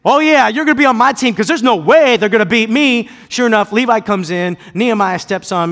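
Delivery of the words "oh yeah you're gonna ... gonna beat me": put-on loud, high cocky trash talk to his kids; talking smack. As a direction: brag like you can't lose. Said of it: "I'm talking smack".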